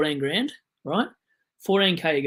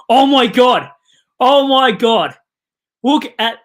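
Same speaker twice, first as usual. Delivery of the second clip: much louder, higher oh my God; excited shout; 7 semitones higher, 13 dB louder, slower than usual. Real, not acted. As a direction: burst out in real amazement. Reacting to a big score.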